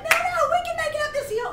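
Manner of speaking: high, pleading pep talk; high-pitched urging. Retold or imitated. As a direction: plead in a high voice to keep someone going. Urging a flagging runner on.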